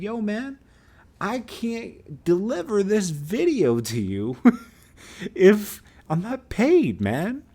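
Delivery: slow, high-pitched protest; exasperated pleading. Retold or imitated. Unpaid video maker refuses the promoter, exasperated insistence. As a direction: stretch the words out, straining to reason with him. Retold standoff over unpaid work.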